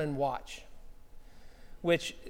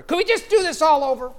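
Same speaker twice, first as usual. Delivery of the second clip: higher, louder, gleeful request; excited plea; 8 semitones higher, 7 dB louder than usual. Retold or imitated. Pastor delighted by the compliment, asking to do it again, excited and raised. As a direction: ask to replay it, thrilled. Pastor wishes he'd recorded it.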